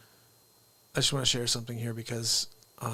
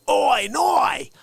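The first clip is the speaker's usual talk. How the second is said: mock Australian accent, shouted and high; Steve Irwin Australian accent; 10 semitones higher, 6 dB louder than usual. Host mimicking Steve Irwin's Australian accent, shouted and high. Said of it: "what did you say".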